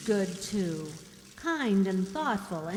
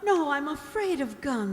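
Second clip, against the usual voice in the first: higher, louder fearful protest; frightened refusal; 6 semitones higher, 5 dB louder than usual. Acted. Helen refusing the gun, higher and scared. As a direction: pull back in fear of the gun.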